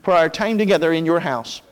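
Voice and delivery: low, hushed opening prayer. Acted. Pastor praying over the congregation before the message, hushed and reverent. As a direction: pray reverently, pitched low and softened.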